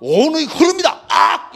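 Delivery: high, loud proclamation ending in a yell; defiant shout. Acted. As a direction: shout it down, then a sharp cry. Acted shouted retort to Yama.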